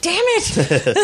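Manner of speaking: laughing mock frustration. Frustrated retold reaction, laughing.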